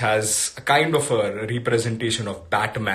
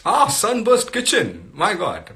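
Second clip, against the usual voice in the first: delighted gasp of recognition, pitched up and drawn out; pleasantly surprised; 7 semitones higher than usual. Real, not acted. Host spots an unexpected viewer joining, pleasantly surprised. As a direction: greet an unexpected guest with happy surprise. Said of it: "that's a pleasant surprise".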